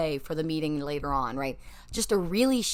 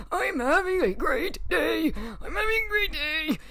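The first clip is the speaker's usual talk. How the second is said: high, forced cheer cracking into a cry; strained cheerful cry; 10 semitones higher than usual. Pretend. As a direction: shout it through tears, pretending to be fine. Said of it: "That doesn't sound like it".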